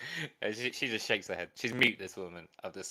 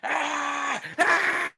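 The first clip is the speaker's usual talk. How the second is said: rising, louder pirate cries, each higher; pirate arr shouts; 4 dB louder, much slower than usual. Acted. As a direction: scream pirate arrs climbing in pitch. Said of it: "He's like".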